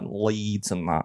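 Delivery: Northern Leeds accent, low and slow; low, drawn-out Northern English. Shown as a demonstration. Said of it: "I could do, like, Northern".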